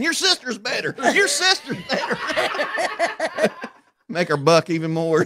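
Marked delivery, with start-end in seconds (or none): 0.0-3.5 high, slow singsong taunt; drawn-out mocking jeer; teasing taunt. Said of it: "He's riding her saying".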